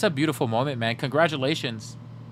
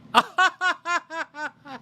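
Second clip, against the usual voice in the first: very high posh titter; hammed-up snooty giggle; a pretend millionaire's laugh; fake rich laugh; 14 semitones higher than usual. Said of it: "I'm working on my rich laugh".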